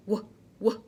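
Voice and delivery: W said as a breathy w sound. Shown as a demonstration. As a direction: model the w sound. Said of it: "It says".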